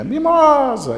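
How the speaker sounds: high, loud drawn-out call; shouted call. Acted. Boy calls his lost little goat, shouting. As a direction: cry out the name into a valley. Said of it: "começa a gritar o nome daquela cabritinha".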